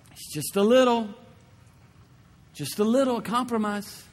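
lightly voiced whispering devil, coaxing; coaxing tempter. Voicing the enemy's soft temptation.

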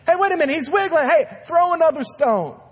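high, loud cry of a bloodthirsty onlooker; excited mob shout. Acted. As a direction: call it out like you can't wait to throw. Preacher acts out stoning crowd.

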